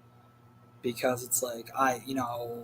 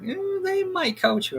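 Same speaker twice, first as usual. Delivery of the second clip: high mock voice of a coworker; imitating Tracy. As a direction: squeak it out like a teasing coworker. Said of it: "she's like".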